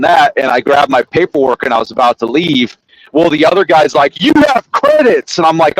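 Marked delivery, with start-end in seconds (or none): 4.2-5.4 high-pitched mock insistence; retold high, indignant objection; indignant clerk. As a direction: imitate a clerk protesting, pitch jumping up. Acted quote of argumentative clerk.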